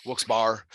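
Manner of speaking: WILKES-BARRE ending in BAR; alternate pronunciation. Shown as a demonstration. Host joking about tonight's pronunciations, variant pronunciation. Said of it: "doing a lot of pronunciation".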